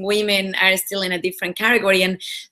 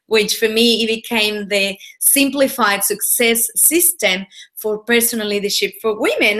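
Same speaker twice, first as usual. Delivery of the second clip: ordinary talk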